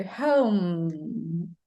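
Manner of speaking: "home" with a drawn-out, hummed M at the end; closed-lip final M. Shown as a demonstration. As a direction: close the lips fully on the M of "home". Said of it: "You pronounce the consonant sound".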